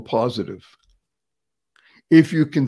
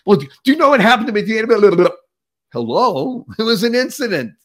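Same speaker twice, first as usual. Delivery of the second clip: excited retelling; 5 semitones higher, 4 dB louder, faster than usual. Acted. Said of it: "you go see him".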